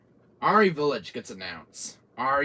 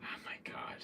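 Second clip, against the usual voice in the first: whispered; 15 dB quieter than usual. Retold or imitated. Horror game retelling.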